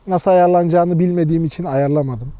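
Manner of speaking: usual speaking voice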